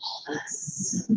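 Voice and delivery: breathy hushed whisper, slow. Acted. Speaker to audience, a slowed stage whisper. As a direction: let it out as a slow secret whisper. Answers own question in whisper.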